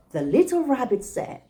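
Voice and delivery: usual speaking voice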